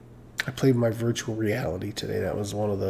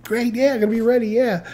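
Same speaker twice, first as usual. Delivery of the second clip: fake upbeat reassurance; 10 semitones higher than usual. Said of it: "I go".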